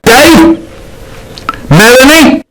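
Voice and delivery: loud high call of names, as if summoning; shouting at a fossil. Pretend. Shouting names at a fossil to make it answer, loud and high. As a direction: yell the names at the stone. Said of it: "you shout at it".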